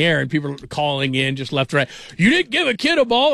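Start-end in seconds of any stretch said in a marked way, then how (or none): 2.2-3.3 much higher, louder incredulous caller voice; mocking outraged caller. Imitating angry callers on his radio show, a high incredulous mock outrage. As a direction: lampoon the callers' disbelief.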